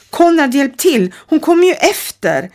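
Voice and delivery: put-on girl calling her friend for help. A narrator's reading. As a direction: yell to Konrad in panic. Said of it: "Danja höjde rösten".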